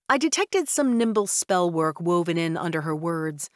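ordinary delivery, nothing marked

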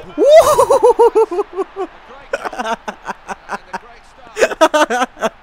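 long loud whooping laugh; shouting laugh. Real, not acted. Gamer celebrating his goal with a long shouted laugh.

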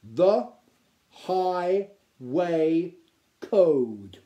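THE, HIGHWAY, CODE, loudly with a gap after each; careful beginner's reading, pausing between "Highway" and "Code"; word by word. A narrator's reading. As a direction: read out "The Highway Code" one separated word at a time. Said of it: "pointed each one out with his spear as he said them".

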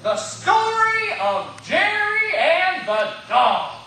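loud, high, drawn-out proclamation; grand title announcement. Acted. Jerry announcing his dog story to Peter, grand proclamation. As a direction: proclaim it slowly at full volume.

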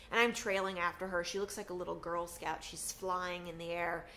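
ordinary talk, nothing marked

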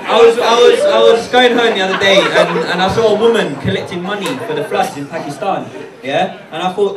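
normal talking voice

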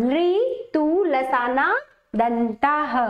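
drawn-out chant of the sutra; slow recitation. Shown as a demonstration. Teacher to students reciting the rule, very slow. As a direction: stretch each word of the rule.

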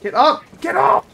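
urgent yell, high and loud; shouting. Real, not acted. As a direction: shout at a downed teammate to rise. Teammate downed in a firefight.

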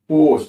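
পোস্ট said loud with a long exaggerated O; exaggerated demo of long-O পোস্ট; mouth-distorted pronunciation. Shown as a demonstration. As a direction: twist the mouth and stretch the O in পোস্ট. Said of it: "মুখটা বিকৃত করে বলছি".

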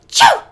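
sharp gasp then a loud high sneeze; huge sneeze. Acted. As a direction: explode into a sneeze. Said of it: "came a volcanic eruption".

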